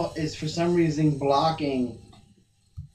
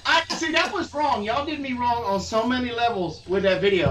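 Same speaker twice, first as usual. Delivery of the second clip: indignant protest; 6 semitones higher than usual. Real, not acted. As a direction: protest being pranked, pitch up high. Protests being pranked in a video.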